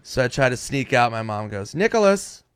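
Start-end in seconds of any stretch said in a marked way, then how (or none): 1.7-2.4 high sharp call of a mother catching her son; mom calling out. Retold or imitated. Mom calling her sneaking son's name.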